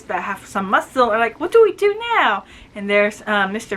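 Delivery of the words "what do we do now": mock whiny boys. Abandoned boys whining together.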